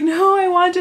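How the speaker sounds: high, strained voice near tears; choked, high-pitched yes while crying; tearful reply. Retold or imitated. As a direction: force the yes out through tears. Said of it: "I was just, like, crying".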